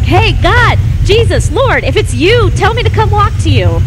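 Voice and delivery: high, loud, hammed-up shout to Jesus; calling out. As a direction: call over the side of a boat. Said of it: "Simon's leaning over the edge like".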